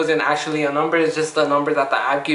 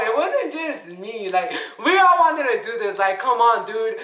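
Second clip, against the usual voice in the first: higher voice through laughter; laughing; 4 semitones higher than usual. Real, not acted. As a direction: protest while laughing. Laughing while defending herself.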